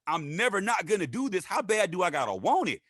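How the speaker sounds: a touch of hungry excitement; eager inner voice. Retold or imitated. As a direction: ask it eagerly. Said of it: "I'm thinking".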